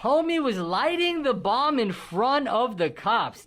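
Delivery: high, loud and slow, stunned; slow, stunned exclamation over music; shocked outburst. Real, not acted. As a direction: blurt it out in disbelief. Reacting to shocking bomb video.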